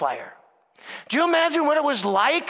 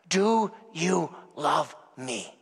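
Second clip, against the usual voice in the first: zacht en langzaam, als Jezus die het teder vraagt; tender, slow question; 6 semitones lower, 4 dB quieter, much slower than usual. Acted. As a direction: ask it slowly and gently, as Jesus would. Jesus' question retold tenderly.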